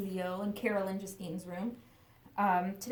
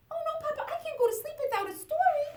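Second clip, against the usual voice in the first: high, eager little chick voice; little chicken voice; 4 dB louder, faster than usual. Acted. Chick asking her papa for a bedtime story, high and eager. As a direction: squeak it up like a small excited chick.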